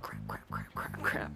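low, soft, repeated mock fretting; mock nervous muttering. Student on their toes all class, low mock-anxious muttering. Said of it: "you were on your toes".

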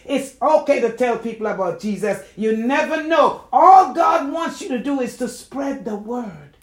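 loud, high, urgent preacher's delivery; impassioned preaching. Real, not acted. Telling church folk to spread the word, impassioned preaching. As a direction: preach it with full force.

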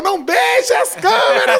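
high, loud, slow mock yell; mock shout. Father yelling up from the balcony, loud mock shout. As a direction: belt it out like a panicked dad.

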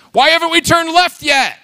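high, loud impatient whine at God; a high impatient nag; whiny nagging. Pretend. Running and nagging the Lord.

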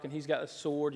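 ordinary talk

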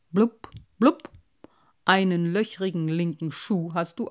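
mocking old carp with burbling blubs; a know-it-all fish looking down on the collection. Carp Cyprinius speaks.

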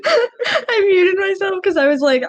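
laughing hard through the words; can't stop laughing; giggling while explaining. Real, not acted. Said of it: "I just started dying".

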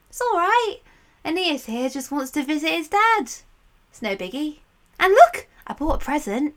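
bright, breezy reassurance; cheerful Sibyl. Acted. Sibyl calling back cheerfully to Charon, breezy. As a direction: wave his worries away cheerfully.

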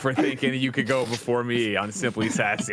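hammed-up outrage, laughing through it; fake-tell off a rival with a grin; playful mock fury at Gino; mock furious callout.

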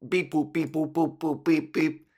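lightly voiced beeping robot; toy robot voice. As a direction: beep boop like a little toy robot.

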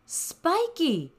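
high ringing call. A narrator's reading. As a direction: call out across the trees. Parents call out.

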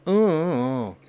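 high, drawn-out whiny "oh, oh, oh"; Korean whiny sentence ending. Shown as a demonstration. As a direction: sing out the "oh, oh, oh" like a Korean complaint. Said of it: "That, that kinda sound".

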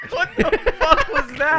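high-pitched, loud laughing outburst; real squealing laughter through the words; laughing disbelief. Real, not acted. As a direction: crack up in disbelief at a terrible attempt. Mocking friend's terrible Irish impression.

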